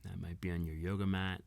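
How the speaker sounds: ordinary talk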